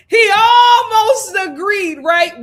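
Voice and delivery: high, loud laughing disbelief; can barely get it out for laughing. Real, not acted. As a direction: crack up while saying it.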